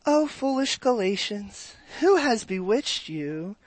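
slow, soft, tender reading of a rebuke; soft gentle reading. Shown as a demonstration. Pastor showing the soft way people read Paul, slow and gentle. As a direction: read it as if God were gentle, slowly. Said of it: "So we go".

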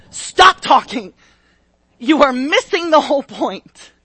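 loud, slowed booming rebuke; a furious divine yell; God yelling. Acted. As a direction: bellow it down as God cutting him off. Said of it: "I didn't mean to yell in your ear".

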